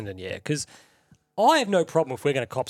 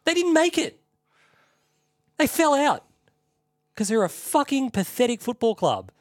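much higher, louder furious shouting; furious rant; 9 semitones higher, 3 dB louder than usual. Real, not acted. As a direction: rage at the rival fans, full volume. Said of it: "I'm gonna do a quick rant".

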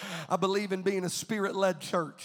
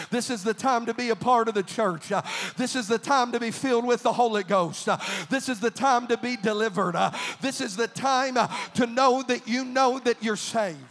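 building preaching refrain; 2 semitones higher, 5 dB louder than usual. Acted. Pastor urging the church, rising louder each line. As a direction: climb the refrain to a rousing peak.

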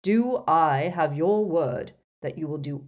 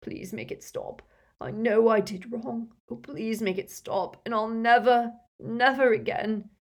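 whimpered start, building higher and louder; sobbing plea; 5 semitones higher, 4 dB louder than usual. A narrator's reading. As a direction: beg tearfully for mercy. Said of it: "he sobbed".

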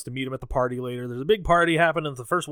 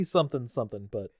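normal talking voice

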